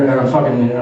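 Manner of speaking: usual speaking voice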